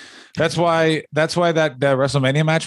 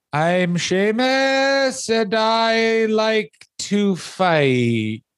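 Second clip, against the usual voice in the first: very slow, much higher drawn-out promo voice; mock Sheamus; 7 semitones higher, much slower than usual. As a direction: stretch every word like a pompous wrestler. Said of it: "his promos ... are this".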